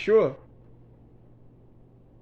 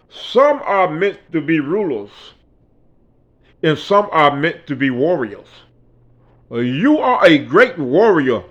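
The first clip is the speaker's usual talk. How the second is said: imitating a Nigerian man; 4 dB louder than usual. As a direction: retell him like a grave elder. Retelling the Nigerian man's speech.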